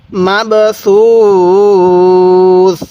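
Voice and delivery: very slow, drawn-out Quran reading; teacher reciting slowly for students to repeat; slow recitation. Shown as a demonstration. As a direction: recite each syllable slowly for students to copy. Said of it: "আরেকবার পড়ুন".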